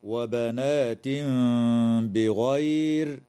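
وبنات بغير with the nasal n hidden into the b, slow; ikhfa demo. Shown as a demonstration. Sheikh showing students the hidden tanween in وبنات بغير, slow. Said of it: "والإخفاء في قوله تعالى".

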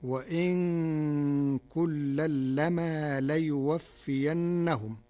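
slow Quranic recitation of وإن كلا لما ليوفينهم with light nun and mim; Warsh recitation. Shown as a demonstration. Teacher recites the verse in Warsh reading, slow and melodic. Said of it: "فتصير الجملة".

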